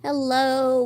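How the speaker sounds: usual speaking voice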